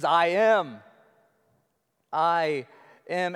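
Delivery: "I am" spoken loud and slow with a pause between; weighty I am. Shown as a demonstration. Preacher proclaiming Jesus' divine name. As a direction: let "I am" land like thunder. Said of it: "It says".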